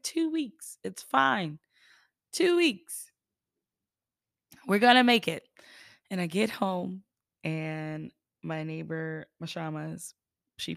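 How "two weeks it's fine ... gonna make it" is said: bright, rising hopeful optimism; excited. Retold or imitated. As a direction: say it like it'll all blow over. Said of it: "and I was like".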